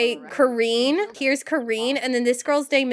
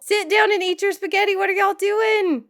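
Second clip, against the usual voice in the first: scolding; 8 semitones higher than usual. Acted. Scolding her sims at dinner.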